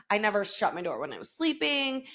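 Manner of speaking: usual speaking voice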